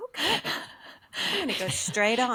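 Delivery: light put-on Irish accent; copying the Irish accent. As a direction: answer the GPS in its own Irish accent. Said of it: "And I'll be like".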